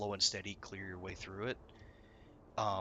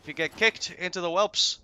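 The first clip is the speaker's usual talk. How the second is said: loud and high, building in heat; mock furious threat; 10 semitones higher, 9 dB louder than usual. Raid leader threatening the raid, loud mock fury. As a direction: yell it like a raid leader docking points. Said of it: "he's like".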